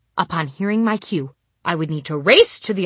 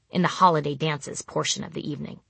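ordinary delivery

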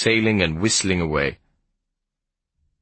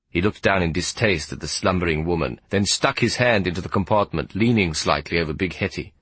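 usual speaking voice

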